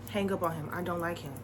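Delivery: low, soft quoted aside; quiet retold verdict. Little brother's quiet verdict retold.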